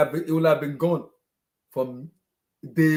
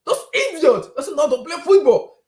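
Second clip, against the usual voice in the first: higher, louder laughing scorn; higher and louder furious scoffing; angry rant; 7 semitones higher, 6 dB louder than usual. Real, not acted. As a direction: rant at the players, half laughing.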